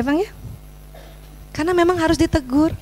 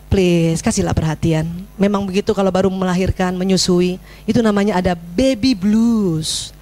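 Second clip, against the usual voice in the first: lower, easygoing motherly advice; calm gentle advice; 6 semitones lower than usual. Shown as a demonstration. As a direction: talk it over calmly, woles. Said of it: "intonasi juga woles saja". (nasihat ibu yang tenang dan santai)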